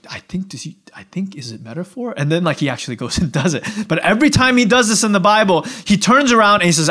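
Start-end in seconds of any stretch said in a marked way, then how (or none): 0.1-2.1 hushed, hesitant aside; acted-out furtive murmuring; puzzled whisper. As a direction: whisper it as a confused private guess. Disciples confer privately, puzzled.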